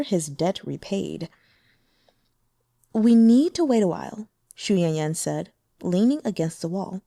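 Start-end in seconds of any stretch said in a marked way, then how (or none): 2.9-4.3 flirtatious demon woman. A narrator's reading. Demon sect woman lecturing the venerable about men, teasing. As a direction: tease him knowingly, amused and worldly.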